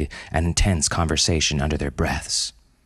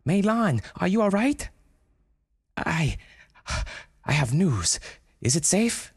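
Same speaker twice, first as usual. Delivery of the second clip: high character voice; 14 semitones higher than usual. Acted. High character voices, Brenda and panda.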